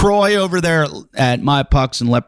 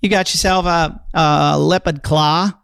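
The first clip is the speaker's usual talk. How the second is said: put-on higher-pitched, slow Boston accent; a hammed-up Boston accent; 7 semitones higher than usual. Host telling the winner his prize, Boston accent. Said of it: "I just did that with a Boston accent".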